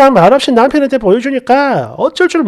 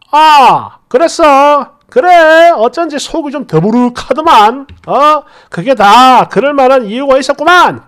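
과장되게 알겠다는 시아버지; 첫마디를 크고 높게 터뜨리는 시아버지 연기; 시아버지 맞장구; 3 dB louder than usual. Acted. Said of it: "이 소리를 들은 시아버지 반응은". (비꼬듯 맞장구치는 시아버지)

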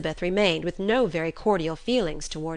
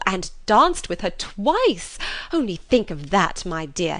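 loud, high, breathless gush; gushing mother; 4 semitones higher, 5 dB louder than usual. Acted. Mother rushing in to tell her husband, loud gushing. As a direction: burst in overflowing with news. Mrs Bennet recounts the ball.

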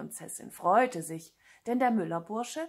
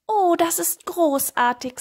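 high, loud admiring gush; admiring flattery; 10 semitones higher, 8 dB louder than usual. Acted. Cat praises the sorcerer's trick, high admiring gush. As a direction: gush in a raised voice.

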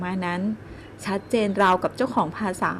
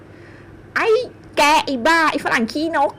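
loud, higher furious yelling; furious cursing; 5 semitones higher, 4 dB louder than usual. A narrator's reading. Woman shaking with rage cursing the foreigner, loud and high. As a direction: spit out the insults in a rage. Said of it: "คำด่าที่พ่นออกมา".

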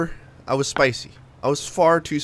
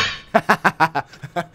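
laugh; 2 semitones higher, 3 dB louder than usual. Real, not acted. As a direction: open burst of laughter. Laughs at own stolen jokes remark.

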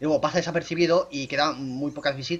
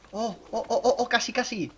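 high, excited oohs; excited anticipation; 7 semitones higher than usual. Real, not acted. Player watching the photo almost come out, excited. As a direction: voice lifting as it nearly works. Thumbnail shot almost lines up.

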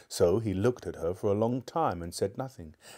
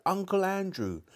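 high cry of sudden recognition; shouted out; 10 semitones higher than usual. A narrator's reading. Boy suddenly remembering calls the name out. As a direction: blurt the name out as memory returns. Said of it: "he shouted out".

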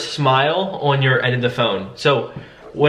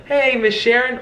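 higher, friendly hello with a smile; smiling greeting; 10 semitones higher than usual. Shown as a demonstration. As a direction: let the smile be heard. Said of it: "add a smile".

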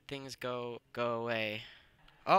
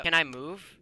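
ordinary talk

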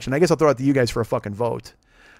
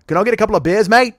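put-on Australian accent; 6 semitones higher, 6 dB louder than usual.

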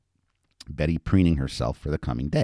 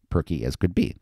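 usual speaking voice

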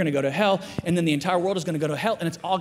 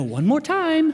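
high, loud coaxing plea; imitated over-keen dad, pitched up; eager dad; 10 semitones higher, 6 dB louder, slower than usual. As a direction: push a toddler for one more take. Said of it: "And I go".

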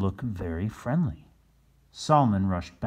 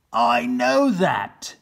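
high, slow, loud snap; snapping back at his son; irritated; 10 dB louder, much slower than usual. Acted. Captain Bill snapping at his son Chris, loud and irritated. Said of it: "said Captain Bill".

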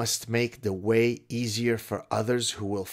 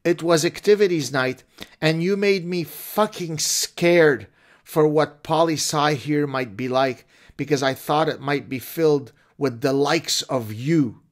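overwrought letter writer; 6 semitones higher, 7 dB louder than usual. Acted. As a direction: ham up a distraught student on the edge of tears. Mock letter read.

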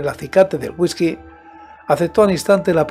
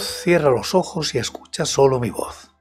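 hushed storyteller; 2 semitones lower, 5 dB quieter than usual. Acted. Hushed intro inviting listener.

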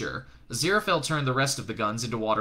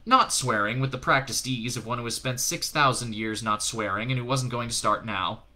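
usual speaking voice

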